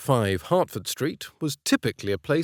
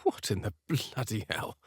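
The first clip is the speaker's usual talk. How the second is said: muttered under breath; 2 semitones lower, 7 dB quieter than usual. A narrator's reading. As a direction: mutter it softly to yourself. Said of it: "I muttered under my breath".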